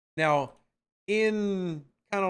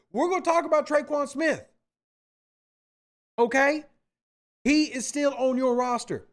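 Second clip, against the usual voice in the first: heated rant; 5 semitones higher than usual. Real, not acted. Host snapping back at chat over Traquan, raised fired-up voice. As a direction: push it up and out, defending himself. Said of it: "Teacher says, "No talk about Traquan".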